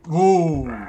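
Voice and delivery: sudden loud, high startled cry; startled yell. Real, not acted. Player startled by a monster in the game, yelling. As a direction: jolt back as something lunges.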